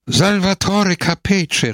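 high, slow, beaming call of his own name; joyful exclamation. Acted. Capece, relieved, proudly naming himself to Don Camillo, exclaiming. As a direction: announce yourself with delight. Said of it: "rief er aus".

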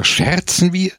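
high, indignant exclamation; crying out. A narrator's reading. Comrade objecting to Don Camillo's question, crying out. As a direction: throw the question out in protest. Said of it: "schrie Scamozza".

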